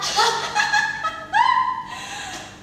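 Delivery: high-pitched burst of laughter; mocking laugh. Denture maker laughs at the word for toothless, high and long. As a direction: cackle high at your own word. Laughs after saying "ompong.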